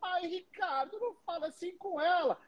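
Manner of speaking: high, shocked mock voice of people watching; scandalized onlooker. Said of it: "as pessoas".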